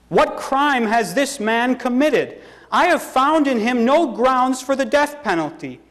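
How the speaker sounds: high-pitched, emphatic protest. A narrator's reading. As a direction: challenge the crowd on his behalf. Pilate protests to the crowd.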